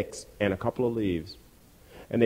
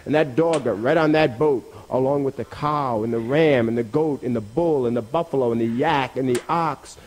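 ordinary delivery, nothing marked

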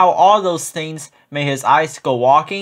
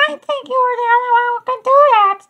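very high, quick voice of the mother goose; a light, high motherly goose; faster than usual. A narrator's reading. Mother goose gently answers her little son, high and quick.

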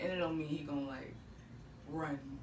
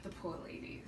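soft pity; 4 semitones lower, 17 dB quieter than usual. Real, not acted. Pity, breathed out.

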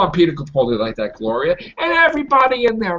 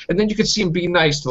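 usual speaking voice

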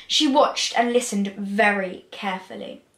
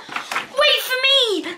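high, slow drawn-out call; eager cry; 12 semitones higher, much slower than usual. A narrator's reading. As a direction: call after them, stretching the words. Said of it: "she cried and raced up the hill".

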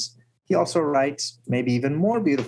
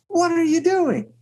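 frightened outburst; 14 semitones higher than usual. Retold or imitated. Rumi, whose book was thrown down the well, cries out in high alarm. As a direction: shriek it as if your treasure were just destroyed. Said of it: "he threw it down the well".

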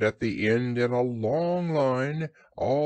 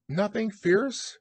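high, timid little voice; high, slow worried question; anxious Piglet; 9 semitones higher, slower than usual. A narrator's reading. Piglet fears what they may discover, high anxious voice.

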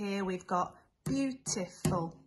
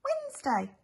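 triumphant. Pretend. Triumphant after writing it.

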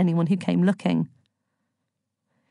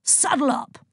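higher-pitched rallying order; hollered command; 7 semitones higher than usual. A narrator's reading. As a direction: call the horse to action with gusto. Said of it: "Zeus hollered at his horse".

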